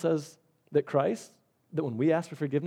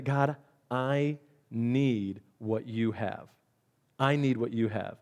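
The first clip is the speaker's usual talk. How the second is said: slow, lower, hushed plea to God; humble prayer; 4 semitones lower, 4 dB quieter, slower than usual. Pretend. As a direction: pray it slowly, humbly. Praying words voiced to God.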